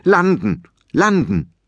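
urgent repeated landing order; urgent command. Acted. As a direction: press the dragon hard to land. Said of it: "drückte fest auf das Halsband".

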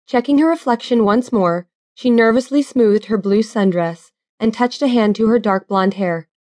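ordinary delivery

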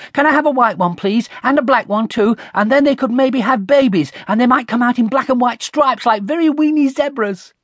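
put-on high, breathless-style child chatter; excited burbling. A narrator's reading. Connie dreaming up pet mice for Dad, burbling excitedly. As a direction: burble on in one eager rush. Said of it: "Do stop burbling".

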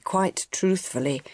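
plain reading voice

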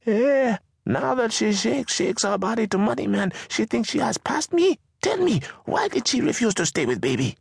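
high, indignant mother's voice; a fed-up mother venting, pitched high; exasperated Mama. Acted. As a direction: complain about the ungrateful daughter. Mama complains about Maisha.

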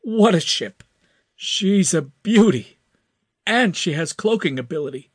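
high, loud delighted cry; exclaiming. A narrator's reading. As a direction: blurt it out in amazement. Max voiced high.

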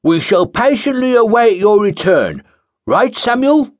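high, loud, self-important acolyte; pompous acolyte. Acted. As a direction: play the pompous zealot.

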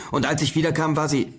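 high, pressing, breaking off mid-sentence; agitated explaining. A narrator's reading. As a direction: pitch up as if desperate to explain, then cut off. Frantic father explaining, gets cut off.

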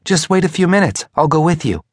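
high voice of a questioning wife; wife's voice. A narrator's reading. Wife's voice in narrated dialogue.

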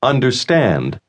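"Understand" with an American R in the middle syllable; North American R. Shown as a demonstration. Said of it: "el sonido de la R norteamericana". ("Understand" con R norteamericana, no española)

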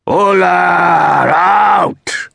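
long, drawn-out high bellow; sudden roar. A narrator's reading. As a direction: bellow it like a protest chant, stretching every word. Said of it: "Sintra roared suddenly".

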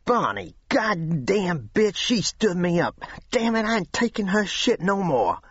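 high, furious rant; young man ranting to Williams about his girlfriend, furious; angry rant. A narrator's reading. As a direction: rage at the girl who stood him up.

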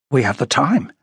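plain reading voice

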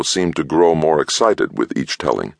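ordinary delivery, nothing marked

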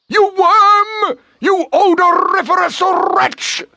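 extremely high, furious insults; tiny creature's shrill sneer; reviling screech. A narrator's reading. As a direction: screech abuse, rolling the r's. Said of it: "The bone commenced to revile the fox".

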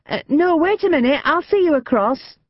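urgent call across the street. A narrator's reading. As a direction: call out to stop her. Louise calls after old lady.

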